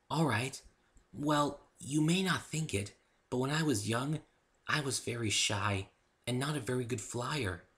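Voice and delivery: high, slow timid pony; bashful confession; shy Fluttershy. A narrator's reading. Fluttershy begins her story for the narrator, high and timid.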